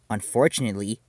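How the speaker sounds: plain reading voice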